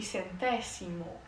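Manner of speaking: centésimo with a clear s, sen-TE-si-mo, not a z; Spanish pronunciation. Shown as a demonstration. Teacher contrasting Spanish with Portuguese, Spanish pronunciation. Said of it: "em espanhol".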